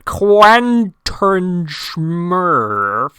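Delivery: "Quentin Smurf" very loud and drawn out; exaggerated slow enunciation. Shown as a demonstration. Streamer correcting misheard name, loud, slow, over-enunciated "Quentin Smurf". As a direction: stretch each syllable of "Quentin Smurf" enormously.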